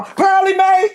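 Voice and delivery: mocking name-calling, high and loud; taunting singsong. Grandmother taunting her daughter with her old name, mocking singsong. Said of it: "to taunt her".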